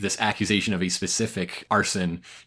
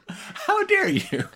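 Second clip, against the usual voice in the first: slow, high-pitched indignation; hammed-up affronted protest; mock outrage; much slower than usual. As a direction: gasp it out like a scandalized diva. Joins in acted outrage.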